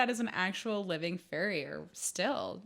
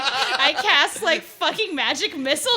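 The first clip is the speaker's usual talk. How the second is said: laughing quote; 5 semitones higher, 8 dB louder than usual. Retold or imitated. Retold roleplay joke, laughing.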